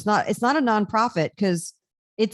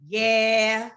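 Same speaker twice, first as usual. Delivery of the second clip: southern yeah. Shown as a demonstration. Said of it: "you got".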